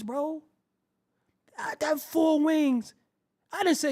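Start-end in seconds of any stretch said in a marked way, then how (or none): 1.6-2.9 I got four wings shouted high and slow; shouted insistence. Acted. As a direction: bellow four, drawn out. Repeating louder, shouting.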